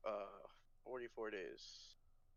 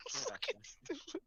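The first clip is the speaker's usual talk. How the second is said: laughing; 11 semitones higher, 5 dB quieter, much slower than usual. Real, not acted. Still laughing at heroin joke.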